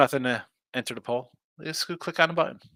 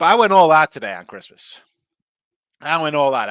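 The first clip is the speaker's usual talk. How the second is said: excited boast; 10 dB louder than usual. Real, not acted.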